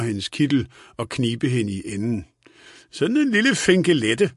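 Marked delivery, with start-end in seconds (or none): 2.9-4.3 higher, lightly roguish tease; a touch of playful flirting; skælmsk. A narrator's reading. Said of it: "sagde han skælmsk". (let drillende og skælmsk)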